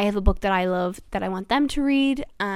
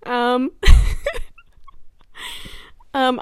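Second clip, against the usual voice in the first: high, loud tearful break between ums; real welling tears breaking through; trying not to cry; 3 semitones higher, 7 dB louder than usual. Real, not acted. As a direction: choke back a sob with an embarrassed laugh. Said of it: "so I don't start crying".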